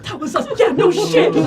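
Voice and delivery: high, loud, breaking into laughter; squealed through helpless laughter; laughing mock quote. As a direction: imitate a blurted confession while cracking up. Mimics the blurted confession, cracking up.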